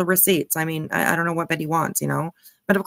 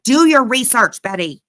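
scolding call; 3 semitones higher, 8 dB louder than usual. Real, not acted. Scolding Betty.

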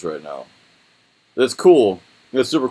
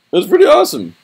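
excited; 8 dB louder than usual. Real, not acted. Excited reaction to phone cases.

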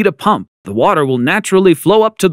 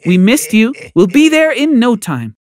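fake sweetness; 7 semitones higher than usual. Mom faking affection.

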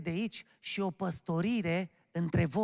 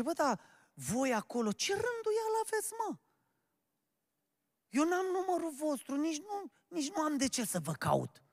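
indignant scolding; 8 semitones higher than usual. Acted. Caller scolding the church for its lack of order, indignant. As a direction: voice climbs high in acted complaint.

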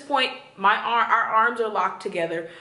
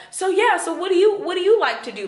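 higher, louder, mock-eager small talk; sudden chirpy switch; 7 semitones higher, 4 dB louder than usual. Woman imitating her date switching moods, suddenly chirpy. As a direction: imitate a date abruptly turning perky. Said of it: "he was just switching too much".

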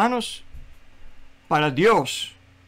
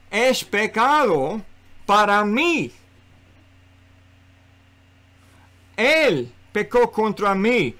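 raised, emphatic pulpit voice; higher, insistent sermon delivery; forceful preaching; 3 semitones higher than usual. Real, not acted. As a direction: press the point home with strong stresses.

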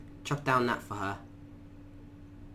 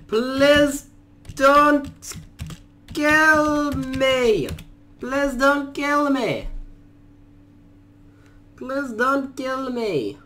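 high, begging, slowing at the end; mock pleading; 9 semitones higher, 5 dB louder, much slower than usual. Gamer begging a creature for mercy, hammed-up pleading. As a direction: plead for your life, hammed-up.

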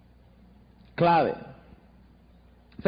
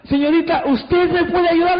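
a hammed-up hopeful customer; pleading client; 7 semitones higher, 4 dB louder than usual. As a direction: ask sweetly and hopefully. Client asks Lorena for help.

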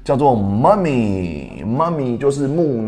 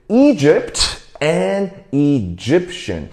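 slow model reading, "Egypt" stressed first, "Egyptian" stressed second; teacher's drawn-out demo of EGypt and eGYPtian; contrasting word stress; much slower than usual. Shown as a demonstration. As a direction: say "Egypt and Egyptian" slowly, stress moving from E to GYP. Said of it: "重音都会不一样诶".

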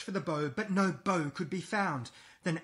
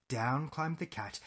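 ordinary delivery, nothing marked

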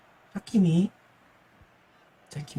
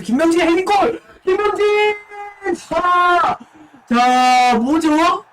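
esports caster; 8 semitones higher, 4 dB louder, slower than usual. Acted. Goal call in live commentary.

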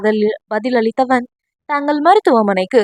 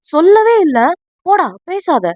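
mock angry; 6 semitones higher than usual. Friend sulking that he was never told, mock angry. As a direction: sulk like a slighted friend. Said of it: "என்று கோபமாக கூறவும்".

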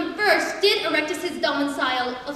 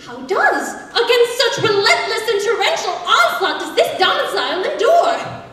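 mildly aghast, pitched a little up; aghast pig; 5 semitones higher, 4 dB louder than usual. Acted. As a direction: ask in theatrical disbelief. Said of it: "Aghast and dismayed".

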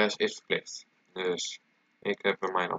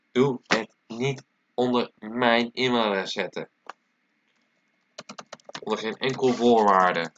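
stern warning; 3 semitones higher, 5 dB louder than usual. Real, not acted. Warns viewers not to misuse email.

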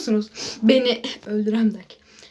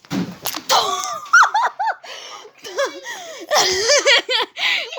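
high shrieking laughter; 12 semitones higher than usual. Real, not acted. Laughing at brother breaking in.

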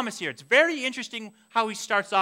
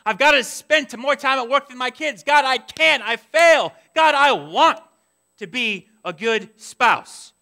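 loud, raised, desperate confession; anguished prayer; 4 semitones higher, 7 dB louder than usual. Acted. Struggling parent and spouse confessing to God, loud desperation. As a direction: cry out to God in frustration.

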